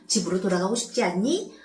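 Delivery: usual speaking voice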